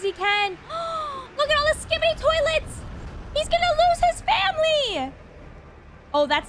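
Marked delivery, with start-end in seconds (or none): 0.6-1.3 sharp shocked gasp. Real, not acted. Toilets swarm Drill Titan's family. 1.4-5.1 alarmed. Real, not acted. Alarmed at the attack.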